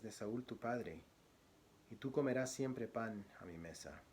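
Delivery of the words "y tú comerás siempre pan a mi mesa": ordinary talk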